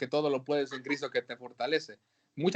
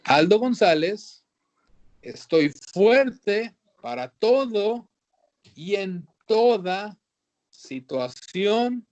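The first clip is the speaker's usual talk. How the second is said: loud, slow, higher affirmation to repeat; bold declaration; 5 semitones higher, 5 dB louder, much slower than usual. Shown as a demonstration. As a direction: proclaim it for the congregation to echo. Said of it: "Repitan conmigo".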